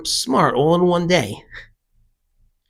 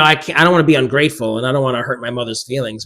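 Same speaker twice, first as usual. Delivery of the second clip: normal talking voice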